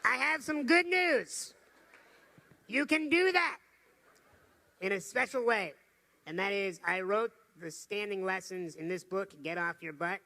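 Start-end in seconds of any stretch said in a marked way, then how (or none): 0.0-5.7 excited announcement. Real, not acted. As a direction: announce it big to the crowd.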